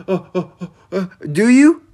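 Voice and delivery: mock surprise before a pointed jab; sarcastic disbelief. Real, not acted. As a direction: scoff at their claim, then challenge them. Sarcastic reply to claimed caring.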